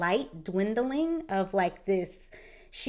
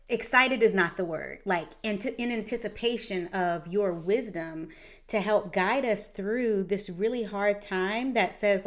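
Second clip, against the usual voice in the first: ordinary talk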